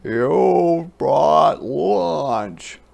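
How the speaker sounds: slow loud goat voice; delighted goat. A narrator's reading. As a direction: drawl it greedily as a goat.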